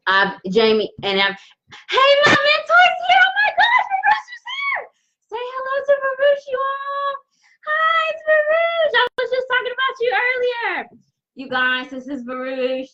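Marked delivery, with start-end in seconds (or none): 1.9-10.9 very high, squealing fan greeting; overwhelmed, sky-high squeal of delight; shocked excitement. Real, not acted. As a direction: shriek with joy at your mentor's surprise arrival. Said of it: "just was really shocked".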